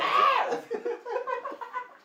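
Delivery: shrill burst of laughter, very high; sudden high-pitched giggle. Real, not acted. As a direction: laugh out at the joke. Laughs at the answer "ahorita.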